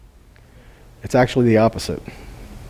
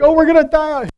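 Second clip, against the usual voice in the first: whiny complaint; 12 semitones higher, faster than usual. Retold or imitated. People's usual complaint mimicked.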